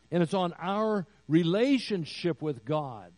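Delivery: rising pulpit emphasis; preaching. Acted. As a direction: drive the point home to the congregation. Preaching climax on God.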